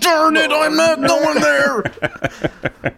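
high, drawn-out mock outrage; milquetoast nerd. Coach forcefully denying a rumor, high slow milquetoast voice. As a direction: squeak out a feeble, folksy denial. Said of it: "milquetoast character like himself".

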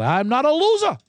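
high-pitched mock protest; Danny Aiello. Gambler dad insists on his dignity, high mock protest. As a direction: lightly imitate an indignant gambler. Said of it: "But he's got a".

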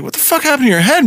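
startled barber asking; shocked barber. Retold or imitated. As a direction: blurt it out in surprise. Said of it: "the guy's like".

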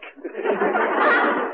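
very high unvoiced giggling cackle; a squeaky silly cackle; high breathy cackle. Inspector's comic laugh.

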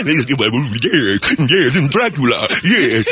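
drawn-out mock vampire voice; Dracula. Morning show parody turning to a vampire, hammed-up voice. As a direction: ham up a Transylvanian count. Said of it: "Dracula is from, he's from Transylvania".

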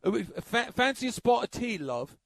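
high English lilt dropping on the last word; English accent. Shown as a demonstration. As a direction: offer tea in a put-on English accent. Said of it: "when I do my English accent".

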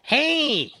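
ordinary delivery

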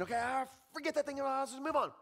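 high, quick, careless wave-off; mock dismissive brush-off. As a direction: brush it off like it doesn't matter. Voicing dismissive attitude.

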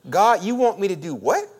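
loud, with "what" shooting up at the end; incredulous question. Acted. Preacher voicing our pushback to God, incredulous. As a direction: hit "what" with a disbelieving rise. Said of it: "You gotta hit that inflection on the back".

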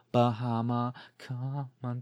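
normal talking voice

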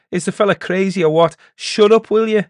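high, loud outraged yelling; furious shout. Acted. Elder enraged by the laughing peddler, furious shout. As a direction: silence him at the top of your voice. Charlesy purple with rage.

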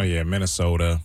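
low, rounded Minnesota vowels; light mock Minnesota accent. Co-host joining the Midwestern bit, Minnesota accent.

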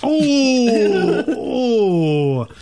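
high laughing oh, then dropping; laughing groan. Real, not acted. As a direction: groan through a laugh at the dig. Reacting to jab.

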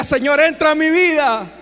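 loud, rising preacher's climax; fervent shout. Real, not acted. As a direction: build to a ringing cry. Clímax del llamado a la conversión. (clamor ferviente de predicador)

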